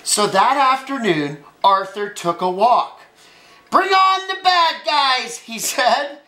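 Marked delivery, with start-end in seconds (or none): 3.7-5.4 high, sustained superhero call; ringing storybook bravado; heroic challenge. Acted. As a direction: call out boldly to imagined villains. Arthur playing superhero, calling out challenge.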